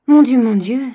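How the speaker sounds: loud, high alarmed exclamation; shocked cry. Acted. Daughter crying out in shock at the sum. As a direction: cry out in dismay. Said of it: "s'écria Eugénie".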